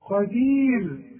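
قدير drawn out with a soft final ر; slow soft ra. Shown as a demonstration. Teacher showing the pause on قدير, drawn out. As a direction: end قدير slowly on a soft ر. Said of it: "عند الوقت رقق".

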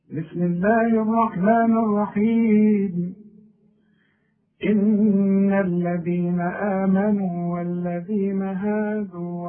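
slow, chanted tajweed recitation; Quran recitation. Acted. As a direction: recite slowly with full tajweed.

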